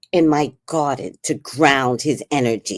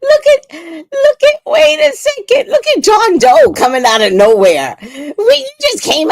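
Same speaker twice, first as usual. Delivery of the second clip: very high, loud excited shriek; excited squeal; 7 dB louder than usual. Real, not acted. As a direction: shriek with delight.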